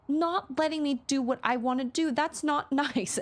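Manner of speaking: imitating a small girl, half laughing; toddler voice. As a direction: mimic the toddler's indignant complaint. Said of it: "And she said".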